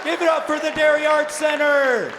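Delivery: loud, high shouted welcome; big high-energy stage opener; festival host hyping the crowd. Real, not acted. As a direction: shout to whip up applause. Hypes up the crowd.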